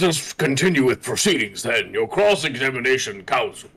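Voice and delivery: a hint of a grand, haughty judge; Vampire Daddy voice. Judge addresses the defense counsel, lightly hammed-up vampire voice. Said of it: "Vampire Daddy doesn't like this".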